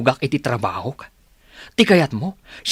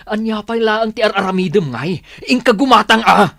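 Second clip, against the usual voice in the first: angry outburst; 5 semitones higher than usual. Acted. Husband quarrels over cooking.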